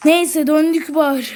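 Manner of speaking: ordinary talk, nothing marked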